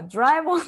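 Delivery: ドラえもん with an English j-like dr; ドラえもん said with an American dr; English dr in Doraemon. Retold or imitated. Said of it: "もう自分でもびっくりしちゃった". (英語風のdrで言ってしまった「ドラえもん」)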